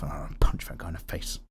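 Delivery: unvoiced whispered mumble; mumbled under breath. Joking about disagreeing, he mutters a threat under his breath. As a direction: mutter it under your breath like a secret grudge. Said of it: "mumble under my breath".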